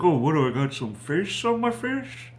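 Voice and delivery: high-pitched voice climbing to a questioning peak; imitated clown, pitch swooping way up; Pennywise. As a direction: lightly play a creepy clown's question, pitch soaring at the end. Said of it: "he's like".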